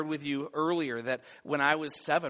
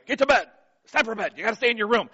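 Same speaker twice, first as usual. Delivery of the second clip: firm dad voice, louder and higher; dad talk; 5 semitones higher, 8 dB louder, much faster than usual. Retold or imitated. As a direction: tell the kid to get back to bed. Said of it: "I do the dad talk".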